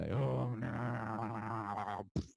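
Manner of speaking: brief amused laugh; a quick real chuckle; short laugh. Real, not acted. Laughs at own remark.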